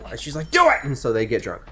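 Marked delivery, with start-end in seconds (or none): 0.5-0.8 much higher and louder, a sudden shouted command; shouted order. Acted. Woman pushing him to drink, shouting the command. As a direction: yell it like a pushy drinking buddy.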